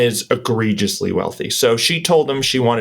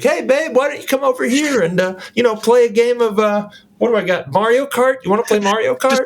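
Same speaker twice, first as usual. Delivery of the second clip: much higher, sugary coaxing voice; sweet-talking boyfriend; 10 semitones higher than usual. Acted. Boyfriend luring his girl away from his funny friend, in a high coaxing voice. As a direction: coax your girl away with fake sweetness, pitched way up.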